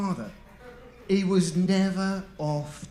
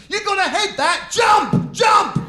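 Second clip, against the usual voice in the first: much higher, much louder shouting; dad yelling; 12 semitones higher, 10 dB louder, slower than usual. Retold or imitated. Father screaming at the football game instead of the caller. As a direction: scream it at the telly mid-match.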